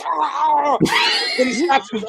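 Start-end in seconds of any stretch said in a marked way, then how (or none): 0.1-0.8 retold scream.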